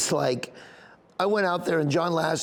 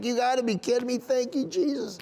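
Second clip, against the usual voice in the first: high, emotional cry of thanks; happy disbelief; 8 semitones higher than usual. Retold or imitated. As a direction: relive the joyful shock, pitch lifted. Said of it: "Just made me happy".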